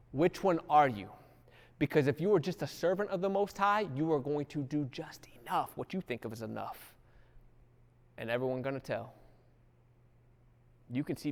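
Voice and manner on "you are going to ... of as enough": sudden soft whisper; hushed warning to the congregation; whispered aside. Real, not acted. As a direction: drop to a whisper for the point. Preacher drops to a whisper.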